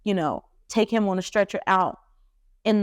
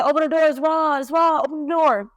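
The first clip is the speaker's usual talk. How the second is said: calling through door; 7 semitones higher than usual. Retold or imitated. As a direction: shout to be let in, urgent. Man calling through the door again.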